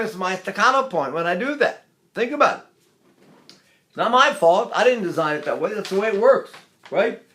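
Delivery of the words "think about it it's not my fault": normal talking voice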